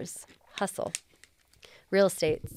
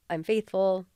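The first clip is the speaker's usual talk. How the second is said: normal talking voice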